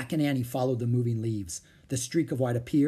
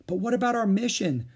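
put-on higher-pitched, girlish objection; concerned protest; 9 semitones higher than usual. Annie reminding Jack of their mission, protesting. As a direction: plead for the plan, pitch up.

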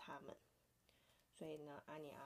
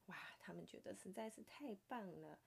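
plain reading voice

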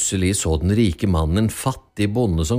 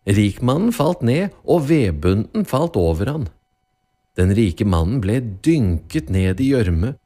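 ordinary delivery

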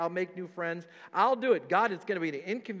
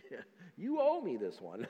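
grudging entitlement; 5 dB quieter than usual. Pretend. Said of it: "someone that says".

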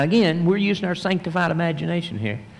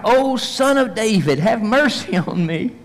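higher, pleading call; a blind beggar calling out for mercy; crying out for mercy; 7 semitones higher than usual. Acted. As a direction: beg the passing crowd, voice raised high. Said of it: "he begins to cry out".

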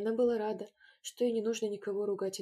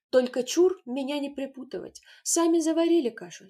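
louder, higher sharp refusal; raised voice; 5 semitones higher, 10 dB louder than usual. A narrator's reading. Grusha to the children, loud refusal. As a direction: Grusha snaps back, won't be dragged in.

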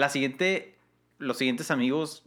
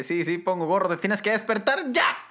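high, louder pushy dog voice; a high, insistent pet voice demanding you get up; voicing the dog; 7 semitones higher, 4 dB louder than usual. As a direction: nag like a dog demanding breakfast. Voicing the dog waking her.

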